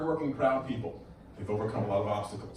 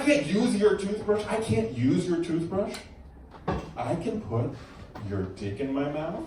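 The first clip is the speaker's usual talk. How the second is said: mild mock yell; 6 semitones higher than usual. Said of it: "Loses her mind, screaming and yelling".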